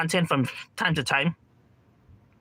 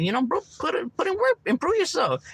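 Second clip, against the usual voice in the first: high, lightly voiced pep talk; encouraging friend; 13 semitones higher than usual. A friend nudging a slacking buddy, high upbeat encouragement. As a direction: coax him to do better.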